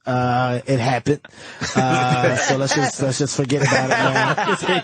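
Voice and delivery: deep, calm, low mock-solemn voice; imitating Patrice.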